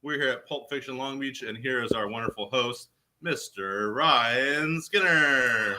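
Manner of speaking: live show opener building to a drawn-out name; show announcer. Acted. Co-host opening a live unboxing show, announcer style.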